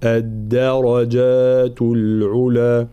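"الدرجات" very slowly, only the راء heavy; correct recitation. Shown as a demonstration. Teacher giving the right reading, slow. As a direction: keep the دال thin in "الدرجات". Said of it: "الراء مفخمة، بقية الحروف مرققة".

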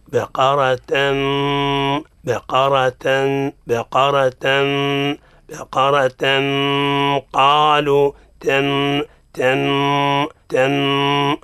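"بقرة" with the final tanween held as a long hum; gradual ghunna drill. Shown as a demonstration. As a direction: repeat "تن" slowly with light t, humming toward "قالوا". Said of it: "نتدرج باش نستعد إلى مخرج القاف".

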